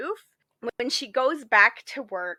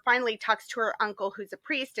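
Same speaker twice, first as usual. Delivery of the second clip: ordinary talk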